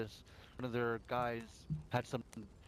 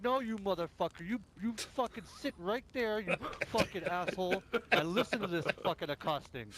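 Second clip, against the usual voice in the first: high, heated tirade; retold furious rant; 8 semitones higher, 4 dB louder than usual. Player reenacting yelling at rival gang member, angry high rant. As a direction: chew him out over the radio. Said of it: "I'm like".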